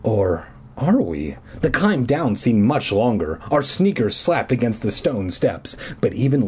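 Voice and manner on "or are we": ominous aside. Pretend. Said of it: "ominous aside".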